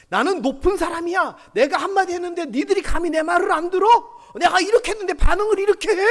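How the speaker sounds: high, loud, haughty scolding; arrogant superior. Retold or imitated. The arrogant man scolding his underlings, haughty. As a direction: look down on everyone. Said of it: "그 말투에서 묻어나오는 겁니다".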